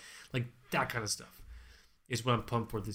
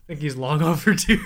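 laughing; 5 semitones higher, 10 dB louder than usual. Real, not acted. Laughing about doing neckbeard.

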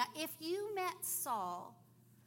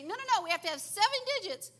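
fast, high, loud insistent refusal; stubborn operator; 9 semitones higher, 11 dB louder, much faster than usual. Retold or imitated. Phone quarrel with the operator.

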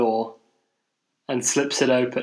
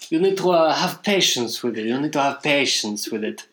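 imitated hesitant Italian accent; halting Italian-accented English, groping for words; 2 semitones higher than usual. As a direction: mimic an Italian urging patience. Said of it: "for a while before he says it".

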